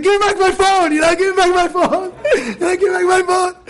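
high, laughing mock demand; husband demanding the phone. Husband demanding his phone back from his wife, half laughing. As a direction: play the caught husband snatching the phone, laughing.

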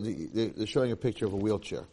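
usual speaking voice

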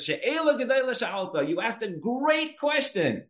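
high, drawn-out singsong praise; imitating admiring Hillel. Hillel praising the man's question, high and drawn out. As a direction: stretch it out in slow, high admiration.

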